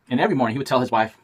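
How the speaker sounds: plain reading voice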